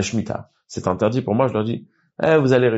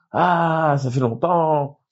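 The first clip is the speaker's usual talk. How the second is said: high, slow gushing hello; effusive mock greeting, much higher and slower; overly warm greeting; 7 semitones higher, much slower than usual. Shown as a demonstration. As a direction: greet him like an old friend you missed. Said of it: "mais pas de manière".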